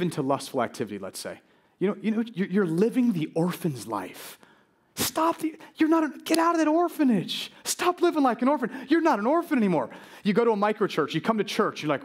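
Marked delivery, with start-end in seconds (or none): 4.9-9.9 high-pitched, insistent preacher's command; impassioned pleading, pitched well up; urgent exhortation. Real, not acted. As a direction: urge them out of it. Exhorts the congregation to stop.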